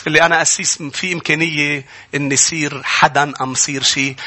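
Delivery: usual speaking voice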